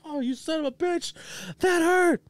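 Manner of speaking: very high, squealed complaint; mock pained yelp. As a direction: squeal it like someone just struck. Acting man hit by golf ball.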